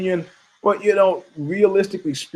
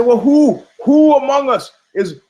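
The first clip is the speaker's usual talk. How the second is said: higher, louder mock whine; mock teammates; 10 semitones higher, 6 dB louder than usual. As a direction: pitch it up like a worried teammate. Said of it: "they say".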